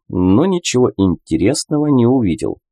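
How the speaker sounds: ordinary delivery, nothing marked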